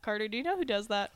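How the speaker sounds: usual speaking voice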